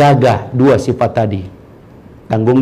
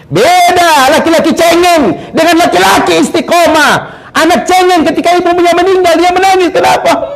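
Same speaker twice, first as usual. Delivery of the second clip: fiery preaching; 5 dB louder than usual. Acted. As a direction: hammer the contrast home. Preacher at full force.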